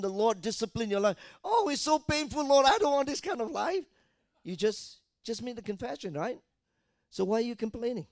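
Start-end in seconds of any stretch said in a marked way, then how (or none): 1.4-3.9 high, loud, whiny mock complaint; mock whining believer. Preacher mocking a believer who whines at discipline, high whiny complaint. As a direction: whine it like a self-pitying churchgoer. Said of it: "Then all of a sudden".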